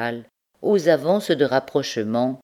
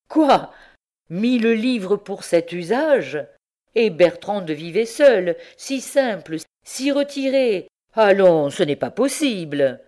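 outraged; 5 semitones higher, 4 dB louder than usual. A narrator's reading. Martin outraged at the accounts.

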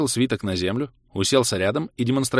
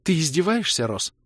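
higher, unsure woman's voice; falter, resolve cracking; higher-pitched shaken question; wavering; 7 semitones higher than usual. A narrator's reading. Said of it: "дрогнула Кира".

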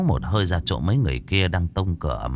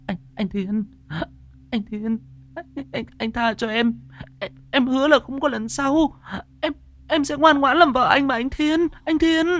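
much higher, stuttering, tearful begging; terrified stammering plea. A narrator's reading. Lài begs her husband Thiên as he takes up the whip, stammering in terror. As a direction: stammer out a crying plea for mercy. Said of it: "lắp bắp".

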